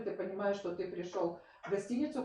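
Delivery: ordinary talk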